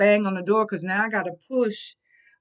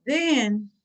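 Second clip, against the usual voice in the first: usual speaking voice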